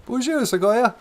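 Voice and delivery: very high, loud character voice; high-pitched, excited sidekick; character greeting. Acted. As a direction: chirp the greeting loudly, way up high. Co-host character chimes in greeting.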